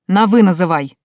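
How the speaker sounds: loud, punched-out reprimand; indignant barked command; angry bark. Acted. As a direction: snap each order like an offended uncle. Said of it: "рявкнул он".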